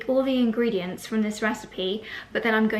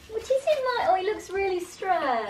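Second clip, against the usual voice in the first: pet talk; 10 semitones higher than usual. Real, not acted. Cooing at the pet.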